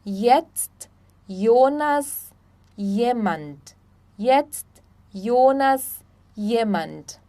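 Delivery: "Jetzt", "Jonas", "jemand" starting with an I glide. Shown as a demonstration. Teacher listing examples, J as I in "Jetzt", "Jonas", "jemand". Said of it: "J sempre vai ter som de I".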